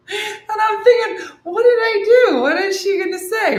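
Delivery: high, loud laughing panic; panicked. Retold or imitated. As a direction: squeal the worry up high. Panicked inner thought, laughing.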